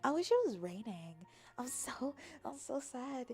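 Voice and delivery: ordinary talk, nothing marked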